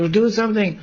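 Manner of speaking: high urgent appeal; acted plea. Radharani begs her friends from the tree, high pleading voice.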